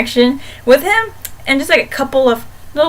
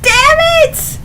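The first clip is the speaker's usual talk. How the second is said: frustrated outburst. Retold or imitated. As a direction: shriek it like a fan betrayed by the plot. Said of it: "I'm like".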